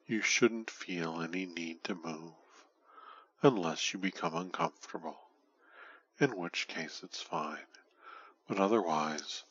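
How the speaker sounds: ordinary delivery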